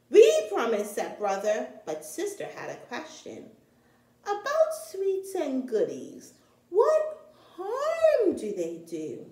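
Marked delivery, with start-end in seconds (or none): 0.1-0.9 high, loud eager cub voice; eager promise. Acted. Cubs answer the doctor. 4.2-9.3 put-on high, slow little girl voice; curious little sister. Sister's voice in story.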